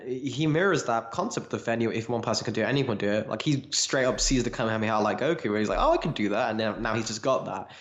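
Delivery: ordinary delivery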